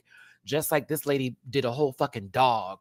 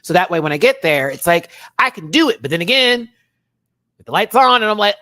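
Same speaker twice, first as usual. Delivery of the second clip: ordinary talk, nothing marked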